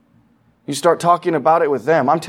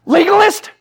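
high, loud indignant jeer of Legalist; mock accusation; 5 dB louder than usual. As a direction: fling the word back as a sneering charge. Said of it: "We're like".

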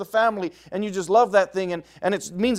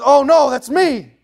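higher, louder, slow dismay; the moment it dawns on you; a slow cry of guilty dismay; stricken realization; 8 semitones higher, 9 dB louder, slower than usual. Acted. David realizes his guilt.

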